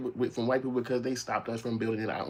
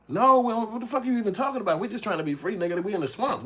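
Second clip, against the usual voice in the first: high, loud, fast protest; exasperated outburst; 12 semitones higher, 4 dB louder than usual. Retold or imitated. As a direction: blurt it out in frustrated disbelief. Acted reply of enslaved people.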